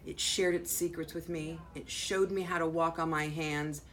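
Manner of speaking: expressive read-aloud with swelling key words; animated picture-book reading with deliberate pauses; story narration. A narrator's reading. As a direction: read to children, punching the important words. Reads picture book aloud to children.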